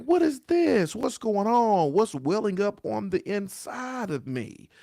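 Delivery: baffled by tears welling up inside; asking yourself what is going on; startled bewilderment. Retold or imitated. Repeats the sudden tears quote.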